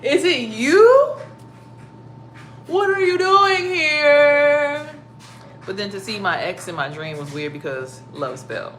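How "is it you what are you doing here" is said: high, very slow, drawn-out calling; eerie spirit call. Retold or imitated. Greeting her ex in the dream, high drawn-out eerie call. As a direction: stretch each word like a ghostly call. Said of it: "it was like".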